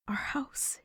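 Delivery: breathy hushed whisper, barely voiced; tearful whisper of disbelief. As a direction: whisper it in awe, eyes full of tears. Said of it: "she whispered".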